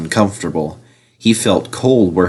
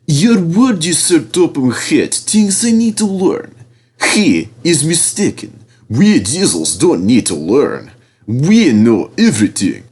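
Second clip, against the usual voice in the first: higher, oily and smug; oily boasting; 7 semitones higher than usual. A narrator's reading. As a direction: boast with silky arrogance. Diesel boasting.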